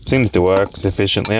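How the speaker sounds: ordinary talk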